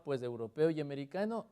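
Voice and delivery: ordinary talk, nothing marked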